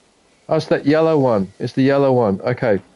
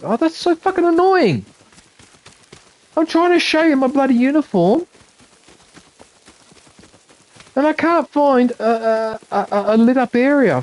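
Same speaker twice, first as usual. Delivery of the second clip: high-pitched, exasperated grumbling; impatient; 7 semitones higher than usual. Real, not acted. Streamer unable to find a sunlit spot in the game, impatient. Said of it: "I don't mean to be impatient".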